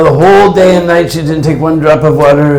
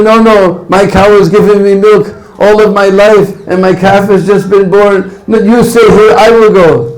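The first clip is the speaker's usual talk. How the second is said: put-on higher, earnest boy's plea; pleading cowherd boy; 7 semitones higher than usual. Cowherd boy begging the lion to take him, higher and earnest. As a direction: insist as a young cowherd offering himself. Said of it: "he said".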